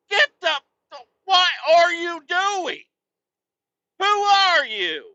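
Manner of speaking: high, loud disbelieving outburst; loud exasperated demand; incredulous yell. Retold or imitated. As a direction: shout at the wife in disbelief. Retorting to wife.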